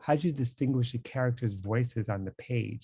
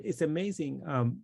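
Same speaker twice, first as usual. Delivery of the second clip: normal talking voice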